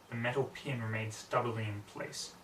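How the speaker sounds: ordinary delivery, nothing marked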